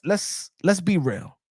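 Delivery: ordinary talk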